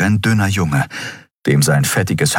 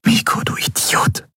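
voiceless, hushed words to an unconscious friend; whisper. A narrator's reading. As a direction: whisper it under the breath. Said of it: "flüsterte sie". (tonlos geflüstert)

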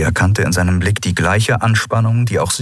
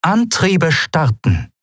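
transmitted command; 10 semitones higher, slower than usual. Acted. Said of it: "sendete sie".

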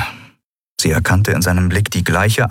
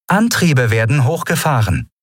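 artificial voice; 6 semitones higher than usual. Acted. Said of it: "eine künstliche Stimme".

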